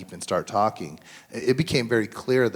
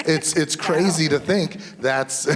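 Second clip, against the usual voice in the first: mock amazement; 7 semitones higher, 5 dB louder, slower than usual. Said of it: "And I'm like".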